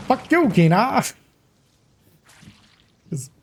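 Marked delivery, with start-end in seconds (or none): 0.1-1.0 high, laughing villain sneer; cartoon villain voice. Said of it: "his cartoon villain voice".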